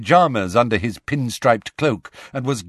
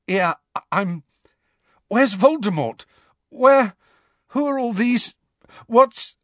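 shaken and panicked; 10 semitones higher, 3 dB louder than usual. Acted. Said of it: "shaking so violently".

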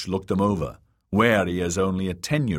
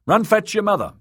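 called high and louder; a narrator's raised call; 5 dB louder than usual. A narrator's reading. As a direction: call the order across the yard. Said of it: "Sahil called to them".

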